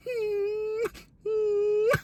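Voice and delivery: high, soft, whimpering hum of a moan. Retold or imitated. Cellmate moaning in his bunk at night, high whimpering hum. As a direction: moan high and thin like someone in distress.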